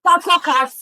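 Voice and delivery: high, loud outburst on the card words; exasperated shout. Real, not acted. Botched game opening, calling the card words.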